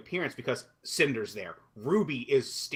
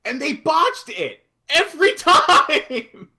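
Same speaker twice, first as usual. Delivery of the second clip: loud, high, drawn-out complaint; exasperated outburst; 12 semitones higher, 9 dB louder, slower than usual. Real, not acted. Fan complaining to co-hosts about botched scenes, exasperated outburst. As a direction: shout it slowly in exasperation.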